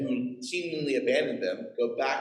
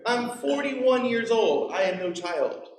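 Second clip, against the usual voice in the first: louder, slower, higher mock-indignant protest; 8 semitones higher, 4 dB louder, slower than usual. Preacher protesting to the stranger on the beach, hammed-up indignation. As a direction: insist on your age in mock outrage. Protesting being called child.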